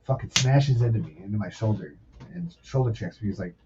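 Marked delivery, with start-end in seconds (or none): none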